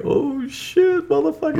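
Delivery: high-pitched comic freak-out; acted, very high voice of sudden realization; mock shock. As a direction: squeal it in pretend alarm. Acted reaction to becoming self-aware.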